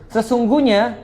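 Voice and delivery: ordinary delivery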